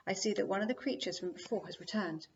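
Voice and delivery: ordinary delivery, nothing marked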